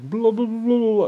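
high, loud run of blahs; mocking babble. Man mocking what everybody says back, mocking babble. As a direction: mimic everyone's talk as noisy gibberish.

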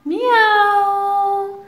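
high, slow, drawn-out "مياو"; cat meow. Retold or imitated. Teacher shows children the cat sound, slow high meow. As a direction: stretch out a sweet kitten meow. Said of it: "هيا نقلد صوت القطة".

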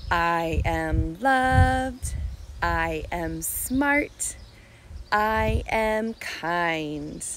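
very slow, deliberate affirmations. Shown as a demonstration. Teacher leads preschoolers in truths about themselves, slow affirmations. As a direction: recite slowly, one word at a time.

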